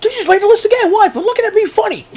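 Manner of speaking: very high cartoon voice; silly list keeper. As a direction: squeak out the threat in falsetto. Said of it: "Bring a little pad and paper".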